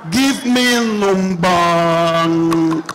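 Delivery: very slow, low, endlessly stretched words; hesitant ordering. Acted. Girl still holding the menu, slow low dithering. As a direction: stretch the last word into a long low hum.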